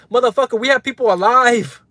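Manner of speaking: real heated outburst, voice pushed up; angry rant. Real, not acted. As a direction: let the anger spill out at them. Ranting at doubters on stream.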